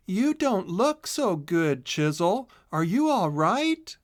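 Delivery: a hint of a worried friend, slow and gentle; concerned friend. A narrator's reading. Boodle checking on her pale friend, lightly worried.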